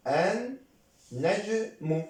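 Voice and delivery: "النجم" very slowly as ann, naj, mu; slow syllable demonstration. Shown as a demonstration. Teacher shows students how it is pronounced, slow and split. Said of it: "هكذا تنطق. So prononce".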